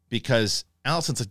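usual speaking voice

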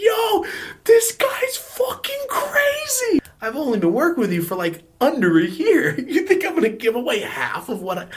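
high, loud, laughing disbelief; retold hysterical laughing. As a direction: crack up while reliving the absurd demand. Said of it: "I just started laughing hysterically".

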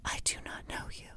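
imitated whisper against his normal voice; mock whisper. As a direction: whisper it back, scared. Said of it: "he's talking to her in a normal voice".

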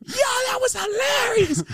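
very high, slow, cracking with laughter; laughing shriek. Retold or imitated. His inner voice loving the prank, a high laughing howl. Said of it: "the thing in me is like".

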